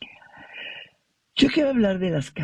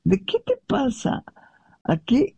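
incredulous wife; 10 semitones higher than usual. Retold or imitated. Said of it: "ella se rió y le dijo".

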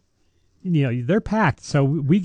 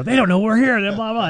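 high, loud, flustered rush; mock panic; 9 semitones higher, 3 dB louder than usual. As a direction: play up the panic before Mass. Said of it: "I'm like".

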